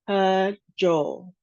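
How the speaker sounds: slow "cajole", split after the first syllable; two separate syllables. Shown as a demonstration. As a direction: break "cajole" into ca, pause, jole. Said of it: "two syllables".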